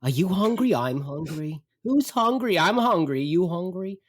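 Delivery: high, whiny mock complaint; complaining mother. Mother in the back seat on a road trip, complaining. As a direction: imitate a mother nagging about her hunger. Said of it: "complaining the whole time".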